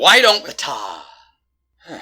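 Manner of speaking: loud, slow start breaking into a sigh; exasperated. Acted. Detective reining in the séance, exasperated. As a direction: start forcefully, then sigh it away.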